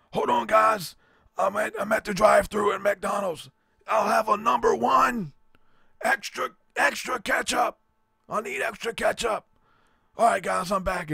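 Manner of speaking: high, loud preacher voice ordering fast food; Lou Engle voice. Retold or imitated. Prank caller in the preacher voice ordering at McDonald's, high and shouting. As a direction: keep the big preacher voice for a drive-thru order. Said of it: "He's, like, talking about".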